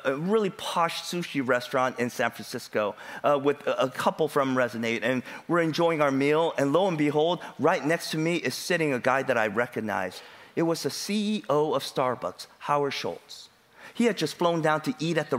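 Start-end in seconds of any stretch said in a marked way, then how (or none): none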